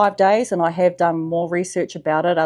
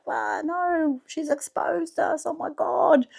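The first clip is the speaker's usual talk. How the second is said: high, soft, squeaky panic of tiny creatures; panicked parasites; 9 semitones higher, 4 dB quieter, slower than usual. Pretend. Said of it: "they're freaking out like".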